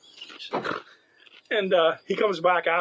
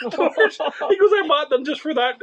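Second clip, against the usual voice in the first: high, loud mock outrage; outraged teacher; 12 semitones higher, 6 dB louder than usual. Teacher lamenting his crystal doorknobs, high loud mock outrage. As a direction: squeal it like a teacher mourning his antiques.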